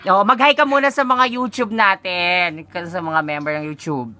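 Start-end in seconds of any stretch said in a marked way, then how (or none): none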